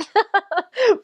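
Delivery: high helpless giggle; giggling fit; helpless laugh. Real, not acted. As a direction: burst out laughing mid-story. Laughing at failed pronunciation.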